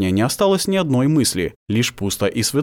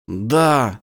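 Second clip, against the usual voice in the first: usual speaking voice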